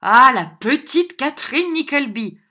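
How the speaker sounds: loud, high cry across the room; shouted toast. A narrator's reading. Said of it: "cria une voix derrière lui".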